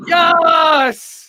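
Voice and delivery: a loud, high burst of joy; excited cheer. Real, not acted. Host cheering the dog's return on camera, loud excited yes. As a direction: cheer as the dog comes back. Dog finally comes back on screen.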